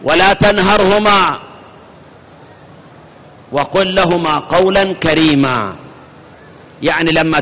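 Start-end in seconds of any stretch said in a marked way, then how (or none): 0.0-5.8 slow, measured recitation of the verse; solemn slow verse recitation; Quran recitation. Acted. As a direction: draw out each word like chanting scripture.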